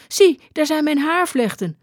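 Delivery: high, urgent showing of proof; insistent woman. A narrator's reading. Aagje showing the skipper her braids, high insistent voice. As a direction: pitch it up, make him look. Aagje proves who she is.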